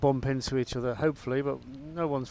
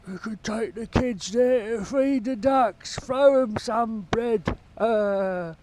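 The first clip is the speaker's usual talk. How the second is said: much higher, louder mock Kent local accent; 9 semitones higher, 7 dB louder, much slower than usual. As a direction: lampoon the angry Kent neighbour in his local accent. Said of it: "They talk like that down here".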